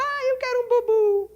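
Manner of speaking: put-on high, whiny baby voice. Lecturer voices a baby wanting his pacifier, high and whiny. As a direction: pretend to be a newborn asking for his pacifier. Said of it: "Ele começa a chorar e fala".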